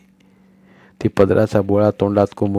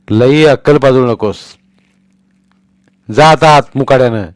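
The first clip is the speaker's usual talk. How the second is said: angry order; 4 semitones higher, 7 dB louder than usual. Acted. Father orders wife away.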